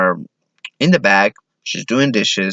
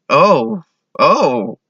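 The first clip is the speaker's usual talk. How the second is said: higher-pitched Oh of realization; dawning realization; 6 semitones higher than usual. Acted. Clueless man realizing his date's meaning, surprised. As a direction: let it suddenly sink in.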